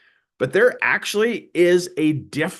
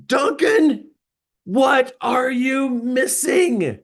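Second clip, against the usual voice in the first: high, drawn-out shout; retold boss's yell; 10 semitones higher, much slower than usual. Boss berating his failing salesman on the phone, yelling. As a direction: yell it slowly, punching out every word. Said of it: "he's yelling at me".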